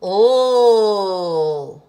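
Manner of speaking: long held L, tongue up; the L sound. Shown as a demonstration. Teacher sounding out letter L for children. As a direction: hold the L out loud and steady. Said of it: "lowercase l".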